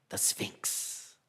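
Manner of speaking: hushed, spooky whisper; whispered Sphinx reveal; eerie whisper. Acted. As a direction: breathe the name like a secret. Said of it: "ominous whispered name".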